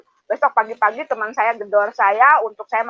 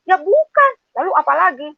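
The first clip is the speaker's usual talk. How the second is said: friend's retort; 7 semitones higher than usual. Retold or imitated. Quoted dialogue with friend.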